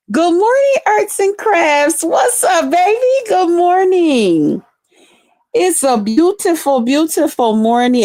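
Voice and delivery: high, stretched, cheerful hello; high slow sing-song good morning; sing-song greeting. Real, not acted. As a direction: greet a friend brightly and slowly. Cheerful greeting to chat member.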